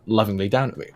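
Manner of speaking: usual speaking voice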